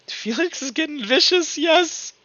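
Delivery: high-pitched laughing cheer; gleeful excitement. Real, not acted. Game master cheering on Felix's vicious move, gleeful excitement.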